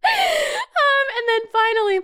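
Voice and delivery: high, laughing um and then finally; giggling high-pitched lead-in. Real, not acted. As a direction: crack up through the words. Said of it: "laughing high".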